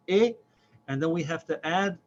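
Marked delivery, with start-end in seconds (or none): 0.1-0.3 short clipped "eh"; just the vowel "eh". Shown as a demonstration. Teacher showing the short vowel "eh". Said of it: "So it's pronounced".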